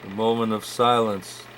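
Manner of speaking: usual speaking voice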